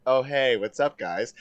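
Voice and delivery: low, soft, unbothered greeting; deadpan. Retold or imitated. He greets his shocked school friends, deadpan. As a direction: drop it down, utterly casual, as if imitating indifference. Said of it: "he fucking deadpans it".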